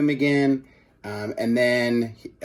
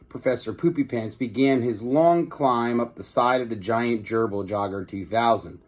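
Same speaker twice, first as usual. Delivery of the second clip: usual speaking voice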